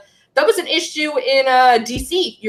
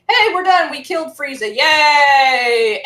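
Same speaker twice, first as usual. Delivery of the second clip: mock celebration; 3 dB louder, slower than usual. Imitating Dragon Ball heroes after beating Frieza, mock cheering. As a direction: play the triumphant heroes, hammed-up.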